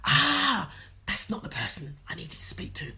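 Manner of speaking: realising the mistake, whispered aside; whispered thought. Retold or imitated. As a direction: drop to a breathy whisper. Private thought on hearing her voice.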